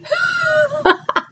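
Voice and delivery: high, teary laugh breaking down; a real laugh that cracks into crying; laugh turning into a sob. Real, not acted. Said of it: "I just had a little crying spell".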